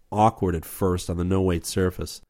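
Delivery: ordinary delivery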